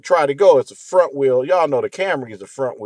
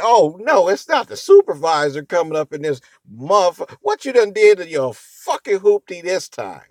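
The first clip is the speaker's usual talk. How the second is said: mock teasing coworkers; 6 semitones higher, 4 dB louder than usual. Coworkers teasing him aloud.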